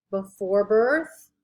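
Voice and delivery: usual speaking voice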